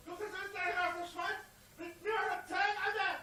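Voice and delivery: ordinary talk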